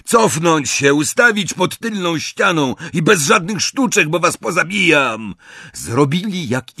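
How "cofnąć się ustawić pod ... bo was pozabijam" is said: nervy raised-voice order, high-pitched; Draco. A narrator's reading. Draco threatens prisoners through the door, agitated high command. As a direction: bark the threat with jittery nerves. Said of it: "rozdygotany głos Drakona zza drzwi".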